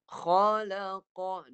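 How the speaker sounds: "Khalaqa" stretched very slowly, kha from the top of the throat; modelling the word for the pupil; heavy kha demo. Shown as a demonstration. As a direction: press the kha at the start of the throat. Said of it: "Permulaan halkum".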